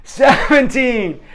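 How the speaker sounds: high, loud called-out numbers; bullhorn counting. Retold or imitated. Teacher counting as boys race away, loud calling. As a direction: call the count over the playground. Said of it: "she got her bullhorn".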